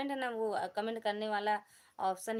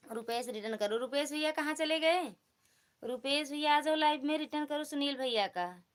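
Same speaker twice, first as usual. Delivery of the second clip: loud, raised-pitch call to a viewer; 5 semitones higher, 5 dB louder than usual. Real, not acted. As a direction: call out for someone who has gone missing. Calls out to viewer Rupesh.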